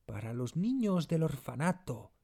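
high-pitched, distressed servant's voice; anguished plea. A narrator's reading. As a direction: plead earnestly for the orphans. Distraught servant pleads for the orphans.

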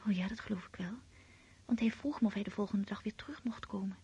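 hushed bedtime whisper; night whispers. Acted. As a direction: whisper so nobody wakes.

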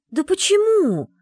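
high, loud, drawn-out cry of disbelief; exasperated exclamation. A narrator's reading. Secretary to the lord refusing the scroll, exclaiming. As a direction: cry out why, stretching it. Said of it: "воскликнула я".

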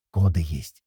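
low, hushed report; loud whisper. A narrator's reading. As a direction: pass on the news in a stage whisper. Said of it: "громким шёпотом сказал".

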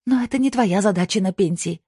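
high, sharp wifely retort; snapped back. A narrator's reading. Wife rebukes retired husband, snapping. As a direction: snap back at his excuse. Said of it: "огрызнулась Кристина".

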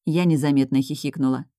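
ordinary delivery

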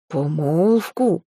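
slow, stunned, dragged-out question. A narrator's reading. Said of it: "остолбенел Велисвет".

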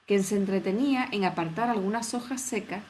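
plain reading voice

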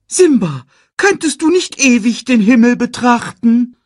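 high, quick cartoon meerkat; chirpy little sidekick voice. Timon nags.

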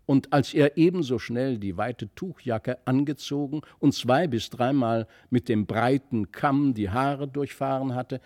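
plain reading voice

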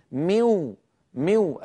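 slow, من ولي becomes ميو, nun into waw; nasal merge. Shown as a demonstration. Teacher demonstrating idgham with ghunna into waw. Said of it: "من ولي تصبح ميو".

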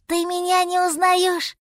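put-on high, childlike pleading; pleading little mammoth. A narrator's reading. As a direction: ask mother plaintively in a small voice.

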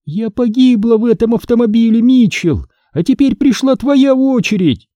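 put-on high, eerie girl's voice from the speakers; a dead girl's gloating threat; ghost girl. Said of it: "звучал голос девочки". (голос мёртвой девочки)

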